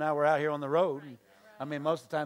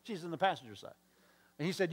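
usual speaking voice

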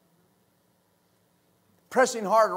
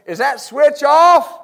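loud, slow, raised-pitch call; 4 semitones higher, 8 dB louder, slower than usual. Retold or imitated. Man in attic checking the switch, calling out. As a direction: imitate a worker checking from the attic.

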